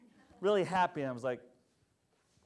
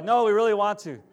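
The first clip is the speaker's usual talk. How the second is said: loud insistent dad; 6 semitones higher, 11 dB louder than usual. Retold or imitated.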